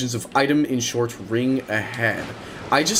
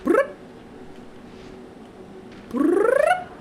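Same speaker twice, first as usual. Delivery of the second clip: silly noise; 3 dB quieter than usual. Pretend. Mocking enemy sound in game.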